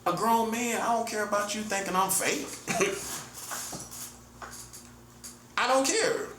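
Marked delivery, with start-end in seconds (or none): 2.5-4.0 a soft airy chuckle under the breath; quiet breathy laugh. Real, not acted. Laughing softly at doubters he calls teenagers, quiet breathy chuckle.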